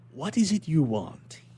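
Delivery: slow, measured inspector's question; slow stern question. Acted. Inspector questioning the prisoner, slow and measured. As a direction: ask it slowly, weighing each word.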